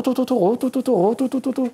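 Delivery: high, rapid vocal imitation of a bike bouncing off the rev limiter; fast, high-pitched engine noises; engine revving at the limiter. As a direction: mimic the engine screaming at the cutoff. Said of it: "Au rupteur, oh".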